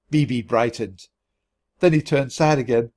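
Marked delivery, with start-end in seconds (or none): none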